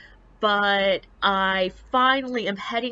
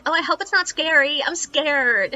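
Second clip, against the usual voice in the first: high, whiny imitation of a nervous schoolgirl; mock scared girl; 7 semitones higher, 4 dB louder than usual. Schoolgirls fretting to the tour guide, mock whiny fear. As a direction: ham up a jittery seventh grader. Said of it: "be like".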